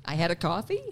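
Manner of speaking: "coffee" rising into a question; demonstrated upspeak; upward inflection. Shown as a demonstration. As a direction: state it as if asking, lifting "coffee". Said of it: "they upward inflection at the end".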